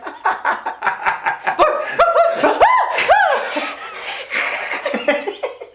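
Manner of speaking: loud, high barks breaking into cackles; dog barking with laughter. Pretend. Co-hosts imagining a dog scare, barking and laughing. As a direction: bark like a dog, then crack up. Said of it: "started barking like a dog to scare her".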